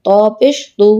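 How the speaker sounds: no stretch on তু; short vowel. Shown as a demonstration. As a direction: end on a short তু. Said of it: "কোথায় দ্রুত পড়ি".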